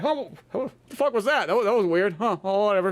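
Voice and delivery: high, confused mumble; puzzled classmates. Retold or imitated. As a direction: act baffled then shrug it off. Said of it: "people would be like".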